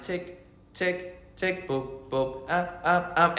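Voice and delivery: soft ticks and boops; imitated glitching sounds. Shown as a demonstration. Demonstrating the sounds layering as you die, imitated glitch noises. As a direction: mimic small repeating electronic blips. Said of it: "So you hear sound".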